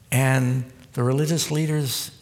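usual speaking voice

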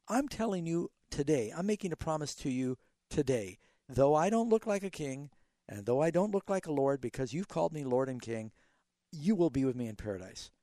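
"today" set apart by pauses, closing each promise; emphasis on TODAY. Shown as a demonstration. Pastor voicing Jesus's promise to the thief, today set apart. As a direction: land on "today", pause, then build through "though I don't". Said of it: "The emphasis is".

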